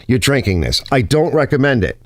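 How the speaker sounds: ordinary delivery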